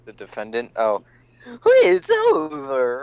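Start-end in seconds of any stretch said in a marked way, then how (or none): 1.6-3.0 put-on high, slow, cracking boy voice; prepubescent voice. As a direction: squeak it out like a boy whose voice breaks. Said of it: "Like a really prepubescent person".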